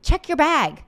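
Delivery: what the speaker wishes they'd told the passenger; pretend retort. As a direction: snap it like a comeback. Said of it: "I want to say".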